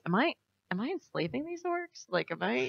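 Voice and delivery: hushed, uneasy self-questioning, acted out; troubled player. Player wondering if they enslave orcs, hushed doubt. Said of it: "it gets kind of a bummer 'cause you're like".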